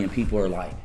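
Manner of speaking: ordinary talk, nothing marked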